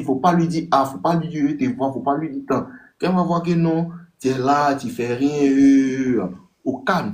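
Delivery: ordinary delivery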